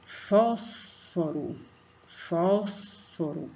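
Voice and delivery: "Fósforo" very slow, "Fós" stretched; stress on first syllable. Shown as a demonstration. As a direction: hold "Fós", then release the rest of "Fósforo". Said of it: "Pronuncie aí pra tia".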